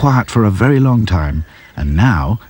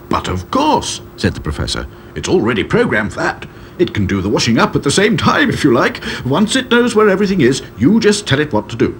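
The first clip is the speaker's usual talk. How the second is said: eccentric professor; 7 semitones higher than usual. A narrator's reading. Professor shows off machine.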